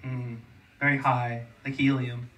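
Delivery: ordinary delivery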